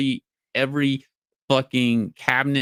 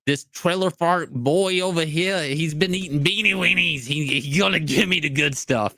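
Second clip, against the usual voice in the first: higher put-on voice, hammed up; mock admiring woman; 5 semitones higher than usual. Imitating a woman picking a trailer park man, mock admiring. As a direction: play a smitten woman in a raised voice.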